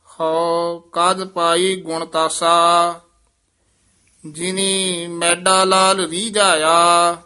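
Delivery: slow measured chant of scripture; scripture recitation. Acted. Teacher recites Gurbani for students, in a measured chant. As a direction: recite each line with devotion.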